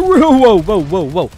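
loud, startled outburst; startled shout. Real, not acted. Player ambushed by an enemy, startled shouting. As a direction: burst out loud at being jumped.

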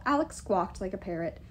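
ordinary delivery, nothing marked

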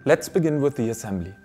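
ordinary talk

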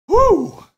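loud high cheer; excited whoop. Real, not acted. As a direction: whoop with joy. Celebrating a card pull.

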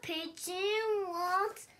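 loud, pleading bird voice; eager pigeon. Acted. Reading the pigeon to the child, loud and eager. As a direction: beg for it like a pushy bird.

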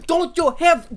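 ordinary talk

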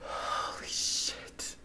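slow breathless whisper; stunned whisper. Real, not acted. Fan stunned by the scene, slow whisper. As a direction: barely breathe it out in shock.

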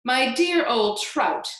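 loud, condescending jibe; crying out. A narrator's reading. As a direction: cry it out slowly as a haughty put-down. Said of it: "Aunt Sponge cried out".

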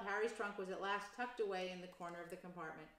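ordinary delivery, nothing marked

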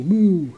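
big mooing cow call; loud cow moo. Acted. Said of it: "He can sound like a cow".

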